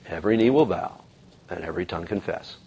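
a hushed restatement of the promise; soft, reflective echo. Acted. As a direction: let the words sink in quietly. Echoing the prophecy, reflective.

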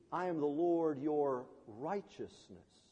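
slow, low, soft proclamation; solemn divine declaration. Acted. Preacher revealing God's name to congregation, hushed solemnity. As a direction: intone it gravely, lingering on each word.

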